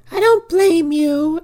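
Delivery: drawn-out high mock sob voice, slow; mock mournful whine. Friends mocking the heroine at the grave: high, slow mock whimper.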